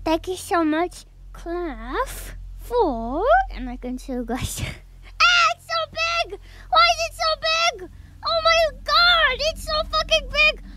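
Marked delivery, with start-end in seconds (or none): 5.2-10.6 excited squeal. Real, not acted. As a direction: gasp at the huge gift. Excited unboxing gift on stream.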